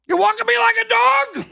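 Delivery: high, loud yell breaking into laughter; laughing yell. Retold or imitated. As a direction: yell the line and crack up laughing. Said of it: "just to yell that one little line".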